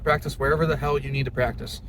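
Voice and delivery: usual speaking voice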